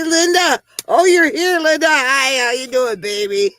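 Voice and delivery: high, gushing welcome; excited high-pitched hello; delighted greeting. Real, not acted. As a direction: squeal hello to a friend who just showed up. Greeting a viewer who arrives.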